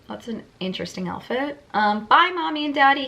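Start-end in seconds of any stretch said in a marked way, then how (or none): 2.0-3.1 put-on child calling out. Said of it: "Helena called from the kitchen".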